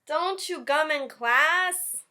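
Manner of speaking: slow, high, loud teacher warning; stern teacher. Acted. Teacher warning David about gum, slow and loud. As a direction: spell out the rule slowly and loud.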